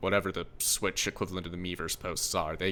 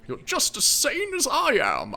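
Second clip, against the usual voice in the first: put-on grumpy old man; 6 semitones higher than usual. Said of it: "my old man voice".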